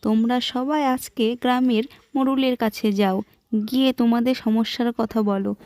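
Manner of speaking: a sister-in-law giving advice; wife's voice. Acted. Wife advises the neighbours.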